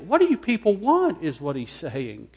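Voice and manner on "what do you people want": loud, high, fed-up demand; exasperated outcry. Acted. Stephen fed up with the stubborn council, shouting. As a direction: throw up your hands and shout it.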